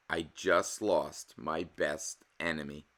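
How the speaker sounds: ordinary delivery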